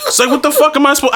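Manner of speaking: ordinary talk, nothing marked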